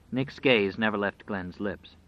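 usual speaking voice

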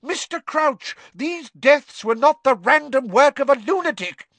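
high, loud indignation; furious. A narrator's reading. McGonagall furiously naming the dead, high and loud. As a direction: fling the names at him.

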